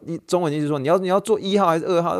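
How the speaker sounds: ordinary talk, nothing marked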